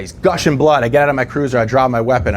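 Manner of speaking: usual speaking voice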